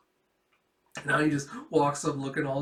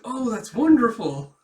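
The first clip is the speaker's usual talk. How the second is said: high, loud gushing; mock delight; 8 semitones higher, 8 dB louder than usual. Father praising daughter's new friendship, mock delight. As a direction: exclaim it with hammed-up joy.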